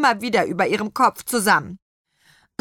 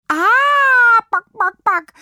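piercing long "aah" into clucks; very high, loud chicken squawk; hens calling out; 9 dB louder, slower than usual. A narrator's reading. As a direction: shriek like a hen in uproar, then cluck. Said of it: "Da riefen die Hühner auch schon".

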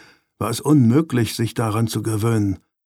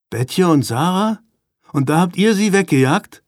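higher-pitched, incredulous questioning; stunned disbelief; 6 semitones higher than usual. A narrator's reading. Brother learning they chased his sister away, asking in disbelief. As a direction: ask as if unable to believe it. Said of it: "fragte Vincent fassungslos".

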